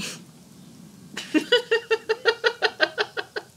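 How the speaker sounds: loud, high giggling fit; helpless laughter. Real, not acted. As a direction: burst out laughing at your own acting. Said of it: "I'm laughing at myself".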